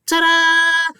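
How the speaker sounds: high, loud showman's ta-da; mock ta-da. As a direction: announce it like a cheesy magic reveal. Punchline of mock Nick quote.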